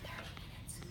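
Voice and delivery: unvoiced whispered answer; whispering. Real, not acted. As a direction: whisper it to the teacher. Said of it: "In your whisper voice, tell me".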